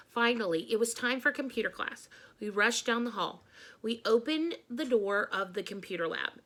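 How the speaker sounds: ordinary delivery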